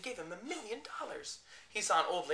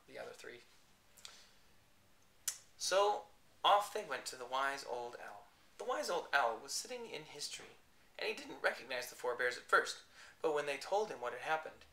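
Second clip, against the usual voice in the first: usual speaking voice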